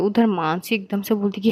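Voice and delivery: plain reading voice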